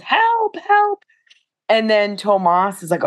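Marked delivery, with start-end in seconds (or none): 0.0-1.0 high-pitched retold cry of help; mock distant yell for help. Retelling Ebba's cry from the fog, high mock yell. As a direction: call for help as if far off on a mountain. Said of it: "Ebba is yelling in the distance".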